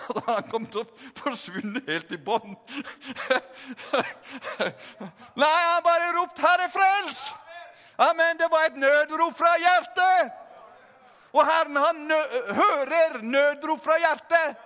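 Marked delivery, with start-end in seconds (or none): 5.4-14.6 high, raised revival-preacher voice; forceful preaching. Real, not acted. Preacher proclaims the heart's cry to congregation, fervent raised voice. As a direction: proclaim it with full-throated fervour.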